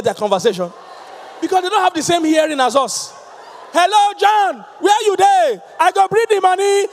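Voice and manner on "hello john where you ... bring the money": shouted high phone greeting; loud phone call. Retold or imitated. Loud caller on phone to John, shouting. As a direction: yell into the phone, Pidgin. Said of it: "on the phone, everybody hears".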